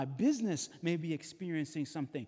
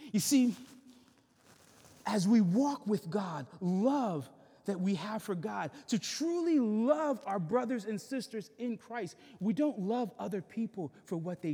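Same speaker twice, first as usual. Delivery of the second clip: fervent preaching; 6 semitones higher, 6 dB louder than usual. Acted. Pastor lifts voice on love.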